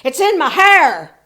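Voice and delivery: loud, high giving-in shout; exasperated Samson shouting. A narrator's reading. Samson finally cracking under Delilah's nagging, shouting. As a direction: yell that you give up. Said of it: "He said".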